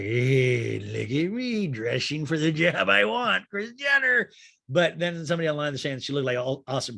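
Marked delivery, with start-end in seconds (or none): 0.0-4.3 mock Rinna. Housewife showing off her Kris Jenner look, hammed-up put-on voice. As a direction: stretch it out, climbing to a squeal on the name. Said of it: "looked like a Lifetime version of Kris Jenner".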